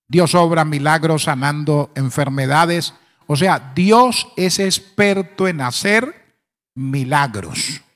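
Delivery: ordinary delivery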